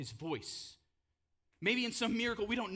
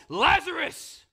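loud call; 13 semitones higher, 14 dB louder, much slower than usual. Acted. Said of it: "Jesus calls".

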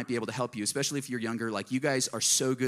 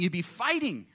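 normal talking voice

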